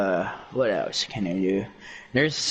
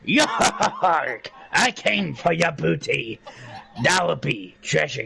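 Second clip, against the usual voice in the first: hammed-up pirate animatronic, pitched up; Foxy; 4 semitones higher than usual. Shown as a demonstration. Showing off a game character after choking, hammed-up pirate. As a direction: bark out a rough pirate cry. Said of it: "I can also do, um, Foxy".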